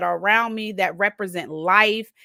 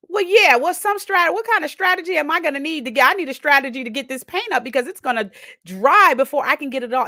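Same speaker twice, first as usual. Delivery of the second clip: high, loud, fast panicked planning; loud, high and rushed anxious thinking; frantic scramble; 6 semitones higher, 4 dB louder than usual. Retold or imitated. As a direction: race to find a plan in a flap. Frantic inner planning over spill.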